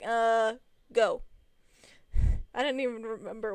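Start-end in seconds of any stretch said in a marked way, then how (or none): 0.0-1.2 a loud, curt brush-off; dismissive. Retold or imitated. Friend and me telling Trent to leave, curtly dismissive. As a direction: shoo him off, unimpressed.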